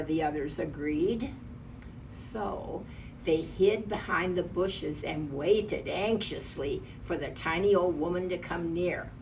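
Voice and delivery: usual speaking voice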